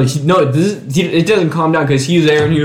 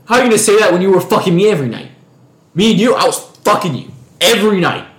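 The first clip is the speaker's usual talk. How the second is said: fast, higher, heated mock rant; retold angry accusation; 6 semitones higher, much faster than usual. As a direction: fire it off like a jealous ex calling her out. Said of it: "he goes".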